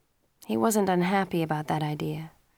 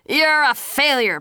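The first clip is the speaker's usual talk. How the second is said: angry father; 8 semitones higher, 7 dB louder, slower than usual. A narrator's reading. Father scolds Pacey.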